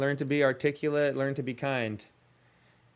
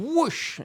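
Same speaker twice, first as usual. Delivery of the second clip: whoosh; 12 semitones higher than usual. Retold or imitated. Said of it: "you're like".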